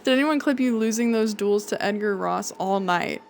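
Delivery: ordinary talk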